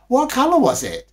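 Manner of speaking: put-on high, loud eager child voice; children asking. A narrator's reading. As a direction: pipe up like an excited child. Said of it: "asked the children".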